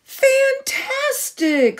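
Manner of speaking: high, slow, stretched-out shout of delight; thrilled cheer. Acted. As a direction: cry it out high and long, amazed. Elizabeth cheers the dragon.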